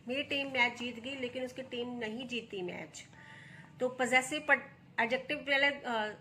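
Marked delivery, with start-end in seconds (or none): none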